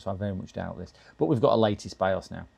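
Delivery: usual speaking voice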